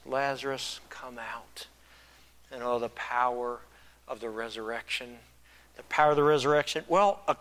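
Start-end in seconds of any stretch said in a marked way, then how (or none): 0.0-1.7 low, soft, falling away to near whisper; hushed retold call. Preacher quoting Jesus' call to Lazarus, hushed. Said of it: "Jesus called in a loud voice".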